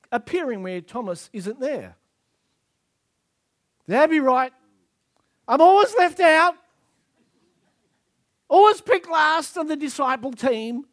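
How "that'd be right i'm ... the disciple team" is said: loud, high hammed-up self-pity; put-upon disciple, pitched up and loud; aggrieved complaint. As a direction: moan loudly about being left out. Acts out Thomas's sulky complaint.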